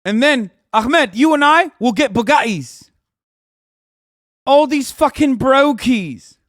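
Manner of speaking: loud, high, swaggering top G voice; puffed-up mock Taliban bro bragging to Ahmed; hammed-up Taliban hustler. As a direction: brag like a wannabe Andrew Tate. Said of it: "they're like".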